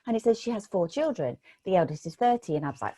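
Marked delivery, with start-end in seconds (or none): none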